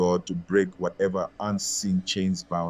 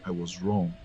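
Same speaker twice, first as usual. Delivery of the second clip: softer, lower, barely above a whisper; near whisper; 4 semitones lower, 8 dB quieter than usual. A narrator's reading. Ngozi asks the market women for forgiveness, hushed. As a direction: confess it softly, head bowed. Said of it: "her voice barely above a whisper".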